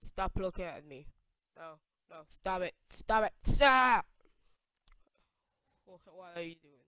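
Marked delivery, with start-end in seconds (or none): 1.6-4.1 rising protest. Pretend. Player telling the staring characters to stop, rising to a yell. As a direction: escalate from firm to yelling.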